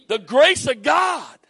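high, loud, slow proclamation; preacher's cry to the congregation; shouted refrain. Real, not acted. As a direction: drive the phrase home.

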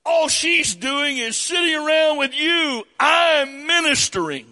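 high, loud shouted whining; whiny accusation. Retold or imitated. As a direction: wail it like a wounded martyr. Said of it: "her accusation".

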